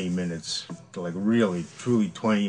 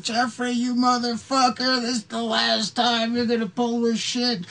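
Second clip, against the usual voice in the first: high, strained mock shout; retold angry yelling; 14 semitones higher than usual. Shooter threatening his friend in the cornfield, mock-furious yell. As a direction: re-enact yelling a threat across a field.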